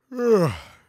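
big hammed-up yawn; loud theatrical yawn; exaggerated yawn. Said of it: "exaggerated yawn".

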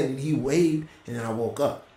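ordinary talk

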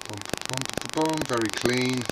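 ordinary delivery

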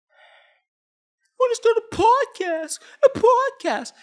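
very high squeaky put-on voice; mock them in a slow, high falsetto; mock podcast wannabe. Mocking a would-be podcaster in put-on voice.

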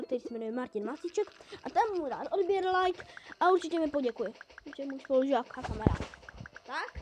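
usual speaking voice

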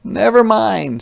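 Jakey cheerfully takes over. Acted. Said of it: "said Jakey".

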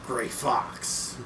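low gruff Snake voice. Pretend. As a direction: growl it like Solid Snake. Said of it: "That's my Snake voice".

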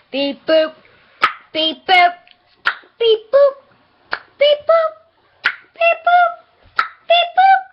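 rising beep boop alien chant. Pretend. Rising robotic beeps.

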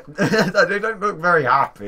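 high, loud, through laughter; laughing. Real, not acted. Joking with a friend about the lights, laughing. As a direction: laugh your way slowly through it. Laughing at how they look.

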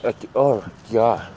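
hoarse, sore-throated voice; talking through a sore throat. Real, not acted. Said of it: "this sore throat is getting me".

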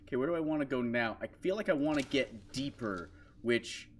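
normal talking voice

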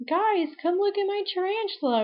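a niece showing off her new pet, high and eager; excited little girl. Retold or imitated. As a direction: call everyone over like a thrilled six-year-old. Voicing six-year-old niece.

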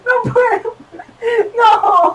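high, laugh-cry struggle; strained, tearful laughing. Real, not acted. Player failing at the exercise, strained laughing wail. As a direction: give up in a high helpless whimper.

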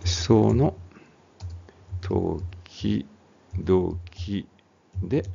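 slow, syllable by syllable; drawn-out, broken-up careful reading; sounding it out. Shown as a demonstration. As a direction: pick through the phrase with long gaps. Sounding out the misread phrase.

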